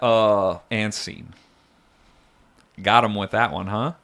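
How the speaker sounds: normal talking voice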